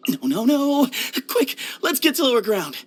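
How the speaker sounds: high, urgent cry of alarm. Acted. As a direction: hurry everyone along in high-pitched alarm. Urgent alarm, flood warning.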